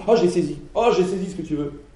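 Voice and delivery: light up suddenly; mock eureka. Student grasping an idea, loud mock eureka.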